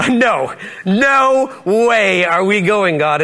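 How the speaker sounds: long drawn-out mock refusal; emphatic refusal. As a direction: stretch out a comic, flat-out no. Acted refusal by first six called.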